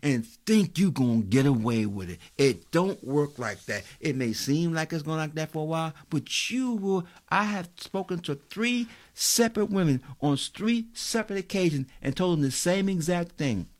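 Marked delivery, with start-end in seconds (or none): 0.0-10.4 stretched-out sermon climax; preaching at full force. Real, not acted. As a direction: drive it home to the congregation.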